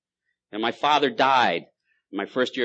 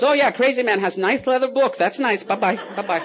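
higher, louder clerk voice brushing him off; mocking store clerk; 12 semitones higher, 6 dB louder than usual. Clerk shooing off the crazy man, higher and louder. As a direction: play the clerk dismissing a crazy customer. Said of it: "he goes".

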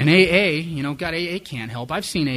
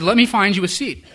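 chirpy, loud and high greeter voice; eager greeter; 7 semitones higher, 9 dB louder than usual. Retold or imitated. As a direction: gush it like an overeager host.

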